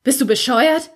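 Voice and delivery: angry outburst. A narrator's reading. Brother grabbing Toni, angry high outburst. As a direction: throw it at her in fury.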